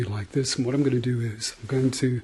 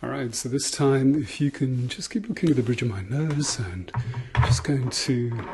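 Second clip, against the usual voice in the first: ordinary talk, nothing marked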